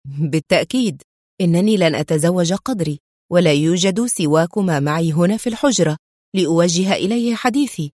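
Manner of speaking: usual speaking voice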